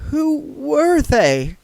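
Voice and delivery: put-on frightened young woman after the attack; trembling question. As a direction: ask it still trembling. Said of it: "she said".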